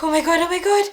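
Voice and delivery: high, loud replay of panic; retold tearful panic. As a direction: cry out in alarm, acting it out. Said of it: "tears just started coming down".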